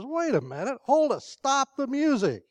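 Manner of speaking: talking donkey. Acted. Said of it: "The donkey says".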